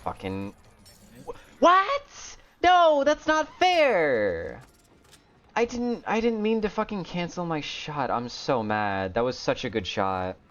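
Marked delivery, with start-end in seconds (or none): 1.2-4.6 very high, loud, stretched protest; high, loud cry of outrage; outraged shriek. Real, not acted. Outraged at cancelled shot. 5.6-8.1 high frustrated complaint. Real, not acted. Frustrated after misplay.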